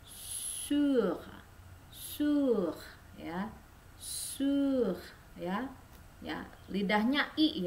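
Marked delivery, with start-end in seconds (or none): 0.1-5.0 "Sur" repeated with rounded u; French sur drill. Shown as a demonstration. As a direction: drill the vowel. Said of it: "cara mengucapkannya".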